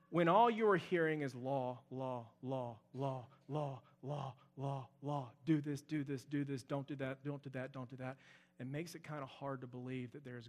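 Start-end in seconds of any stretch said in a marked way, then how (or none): 1.3-8.2 softer, monotone chant of rules; quiet droning repetition. Retold or imitated. Pastor mimics a childhood of nothing but law, quiet droning chant. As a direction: drone the words like a tired rulebook. Mocking endless rules, repeated words.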